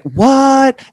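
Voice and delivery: high, loud "what?"; can't believe her ears; disbelief. Real, not acted. Fan hearing her idol came on the show, stunned.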